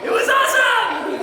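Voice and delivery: high-pitched gleeful exclamation; gleeful boast. Retold or imitated. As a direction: exclaim it with mock triumph. Gleefully retelling his own stunt.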